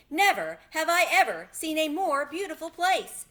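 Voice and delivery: high, loud and delighted; joyful lion. A narrator's reading. The lion admiring the lovely new forest, joyful and loud. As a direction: marvel at the forest with open joy. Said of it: "looking around him with joy".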